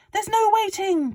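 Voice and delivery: high, loud, gleeful father; excited Mister Cranky. A narrator's reading. Father urging George on about the medicine, high and loud. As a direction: crow it like a man with a scheme. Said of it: "cried Mister Cranky".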